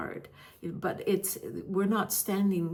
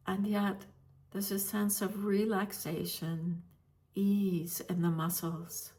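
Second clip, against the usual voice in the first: guided meditation. Real, not acted. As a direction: gently guide listeners into stillness, leaving space between phrases.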